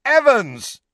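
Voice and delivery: high, loud, drawn-out call; calling after her. A narrator's reading. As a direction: shout to make her turn round. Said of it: "James shouted after her".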